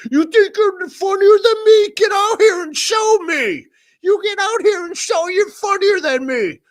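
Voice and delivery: high, loud mocking yell of the streamer; mock challenger shout. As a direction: taunt like a show-off bully, shouting.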